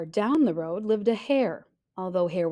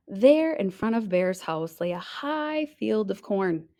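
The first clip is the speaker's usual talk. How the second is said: ordinary delivery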